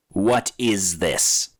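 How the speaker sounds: slow, heavy creature demand; a gargoyle pressing for an answer. A narrator's reading. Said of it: "the gargoyle demanded".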